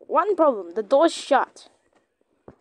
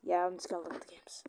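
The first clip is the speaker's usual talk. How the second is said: fast unvoiced whisper; 7 semitones lower, 15 dB quieter, much faster than usual. Shown as a demonstration. Player whispering the line again to himself. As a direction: breathe it quickly. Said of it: "repeats whispered".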